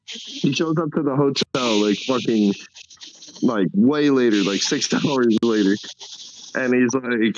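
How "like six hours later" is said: usual speaking voice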